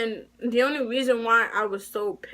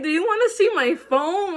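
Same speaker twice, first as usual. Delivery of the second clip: high, louder, indignant offer; indignant protest; 9 semitones higher, 4 dB louder than usual. Retold or imitated. Accused student offering proof to the school official, indignant. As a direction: protest your innocence with pitch jumping up.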